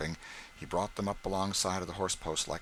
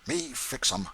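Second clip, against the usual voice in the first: approving grin; 4 semitones higher than usual. A narrator's reading. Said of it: "with an approving grin".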